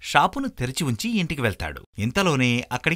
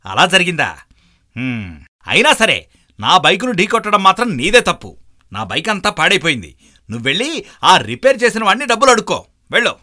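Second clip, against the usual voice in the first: high, loud dismissive scolding; loud blaming; 6 semitones higher, 9 dB louder than usual. Acted. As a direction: brush him off and send him away. Biker still blames cyclist.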